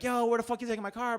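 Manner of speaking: high, quick mock yell without full volume. Arrested driver in back seat to tow guy, mock yelling. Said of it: "I'm yelling at the guy".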